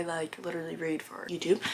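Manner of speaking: soft whisper, as if letting them in on it; whispered aside. Real, not acted. Vlogger confiding to viewers in a whisper. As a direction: drop to a secret whisper.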